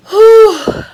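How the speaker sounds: high, loud exhale of delight; excited whew. Real, not acted. Overwhelmed by the color, excited whew. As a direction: blow out a thrilled whew. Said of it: "getting a little excited".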